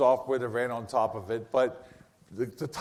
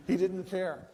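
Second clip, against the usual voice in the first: usual speaking voice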